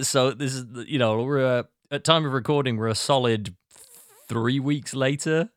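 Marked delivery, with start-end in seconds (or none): none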